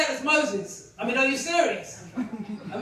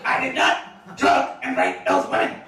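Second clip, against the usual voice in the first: much lower, slow, put-on mock Cosby voice; Bill Cosby impression; 4 dB louder than usual. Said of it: "they might cast him as Bill Cosby".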